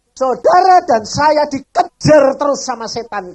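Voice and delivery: loud, slow sermon exhortation; booming pulpit voice, slow; 1 full-force preaching. Real, not acted. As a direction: drive the point home to the congregation.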